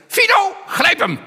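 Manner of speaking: very high, loud, slow parrot shriek; parrot shouting. Pretend. The parrot sets the Rottweiler on the repairman, shrieking. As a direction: screech the attack command. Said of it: "Waarop de papegaai zegt".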